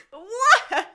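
high, loud disbelieving yell; incredulous shriek. Real, not acted. Player seeing the hunt result, incredulous shriek. Outraged at losing egg hunt.

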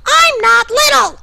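loud, indignant yell; angry shout. Acted. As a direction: snap back loudly, offended. An acted quarrel.

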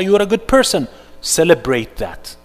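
usual speaking voice

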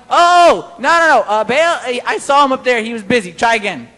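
loud, mocking, amused reassurance. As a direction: Elijah taunting the prophets, grinning. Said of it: "he's like, cracking up".

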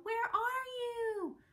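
put-on girl searching for her guinea pig, a slow high call. A narrator's reading. Searching for lost pet.

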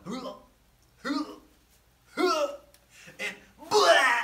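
heaves building to a loud blah; mock gagging, then a big throw-up noise; imitated whale retching. Whale about to throw Jonah up on the beach, building retches. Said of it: "And then the whale starts going".

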